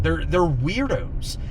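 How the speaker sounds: normal talking voice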